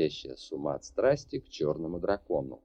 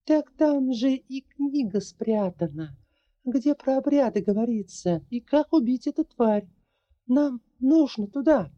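put-on higher, lighter girl's voice; young woman voice; 4 dB louder than usual. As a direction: voice the heroine, earnest and determined. Larri voice, urgent.

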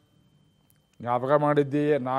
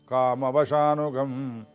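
slow word; much slower than usual. Shown as a demonstration. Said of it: "repeats the word slowly to explain".

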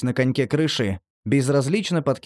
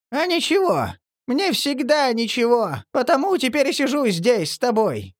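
high creaky ghoul voice; 11 semitones higher than usual. A narrator's reading. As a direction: squeak it out sorrowfully. Marley's high creature voice.

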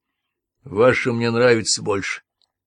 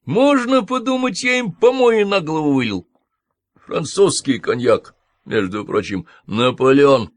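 higher, louder indignant rant; 6 semitones higher, 5 dB louder than usual. A narrator's reading. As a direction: sputter with offended pride. Outraged at the swearing. (возмущённо, громче и выше)

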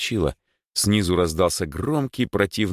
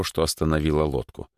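plain reading voice

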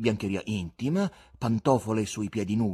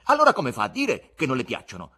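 loud, higher, indignant question; indignant challenge; 4 semitones higher, 9 dB louder than usual. A narrator's reading. Ivan challenging the guest about his poems, indignant. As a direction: challenge the answer at full voice. Acted quarrel over poems.

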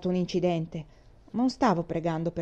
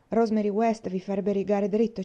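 usual speaking voice

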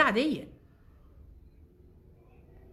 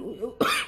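short cough. Real, not acted. Cough mid-sentence.

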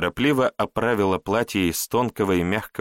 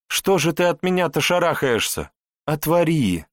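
higher, imploring; tender pleading; 9 semitones higher, 3 dB louder than usual. Acted. Wife pleads with hiding husband at the door, imploring. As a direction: coax him out, pleading her innocence.

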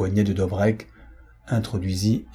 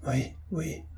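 faint, high, much softer stammer; stammered yes; 6 semitones higher, 9 dB quieter than usual. A narrator's reading. Broken prisoner stammers consent, much softer. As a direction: barely get the words out. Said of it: "balbutia Dobreck".

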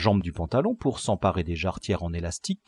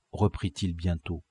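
ordinary delivery

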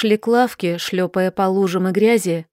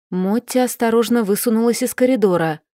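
ordinary delivery, nothing marked

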